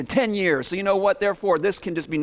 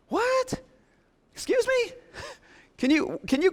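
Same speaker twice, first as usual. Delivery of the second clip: squeaky falsetto, much higher and softer; stunned disbelief; 3 dB quieter than usual. Acted. Shocked listener squeaking at Jesus, high falsetto. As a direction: gasp out the question in a high squeak.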